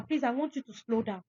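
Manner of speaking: ordinary delivery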